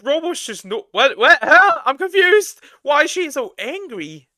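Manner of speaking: high-pitched mock confusion; mock squeaky voice. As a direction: squeak it out like a baffled cartoon. Mocking the angry chat girl.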